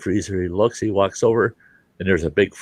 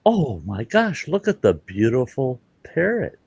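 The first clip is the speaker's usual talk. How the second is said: gushing admiration; 5 semitones higher than usual. Acted. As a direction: draw out the delighted gasp of praise. Preacher admiring parrot in joke.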